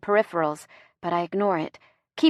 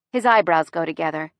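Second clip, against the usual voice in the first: usual speaking voice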